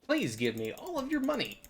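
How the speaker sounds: very high mock-sweet robber voice; hammed-up high-pitched stickup; mugger demand. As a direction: ask for the money in a silly high squeak. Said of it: "And I would be like".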